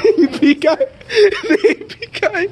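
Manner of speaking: high, squealing through laughter; laughing squeal. Real, not acted. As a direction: gasp it out between laughs.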